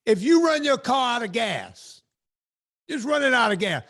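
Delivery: sermonize about calories like a pulpit; preacher voice. Pretend.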